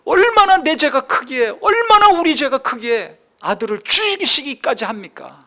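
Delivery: high, loud rhetorical lament; impassioned preaching. Acted. Preacher asking why the Son had to die, impassioned. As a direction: hit each opening hard, then ease off.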